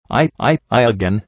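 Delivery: usual speaking voice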